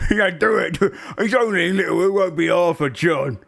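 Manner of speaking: high ogre voice, keen; goading ogre. Acted. Ogre pushing John to bully gnome, high goading voice. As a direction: goad him into it.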